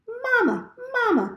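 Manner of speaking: high, tiny peeping chick voice; a newborn gosling peeping for its mother. A narrator's reading. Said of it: "peeped the gosling".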